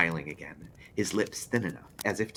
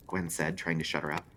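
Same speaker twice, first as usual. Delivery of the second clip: usual speaking voice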